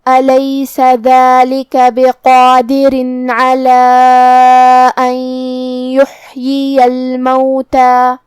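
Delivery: slow chant, stopping on a long alif; tajweed recitation. Shown as a demonstration. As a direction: recite slowly and hold the last alif. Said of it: "کلمت الموتی پہ رکیں گے تو اس پہ مد الف سے رکیں گے". (مد الف پر رک کر تلاوت)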